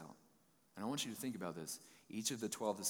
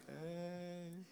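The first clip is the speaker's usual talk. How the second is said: high, airy, offhand "Hey" as if strolling by; casual Jesus greeting; 9 semitones higher, 6 dB quieter than usual. Retold or imitated. Mocking a sandbar theory with Jesus calling out a breezy hello. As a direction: toss it off like a guy on a sandbar. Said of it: "he's like".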